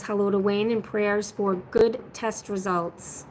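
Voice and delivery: normal talking voice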